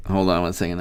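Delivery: ordinary delivery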